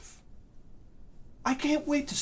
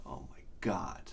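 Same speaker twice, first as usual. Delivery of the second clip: unvoiced, much softer sigh of disbelief; exasperated whisper; 12 semitones lower, 13 dB quieter than usual. Real, not acted. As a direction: mutter it to yourself. Exasperated aside after rant.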